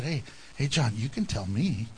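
low, hushed aside; confidential coaxing. Acted. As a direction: coax a secret out of him quietly. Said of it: "pulled him aside and said".

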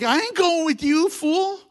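high, loud scoffing refusal; high sassy put-down; mock tough refusal. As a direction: brush him off like a street tough. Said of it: "You'd be like".

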